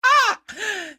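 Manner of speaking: sudden high burst of laughter; high-pitched cackle of amusement; real laugh. Real, not acted. Host bursts out laughing at clip.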